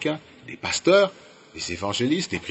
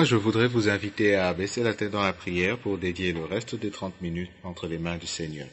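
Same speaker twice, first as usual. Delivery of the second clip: ordinary delivery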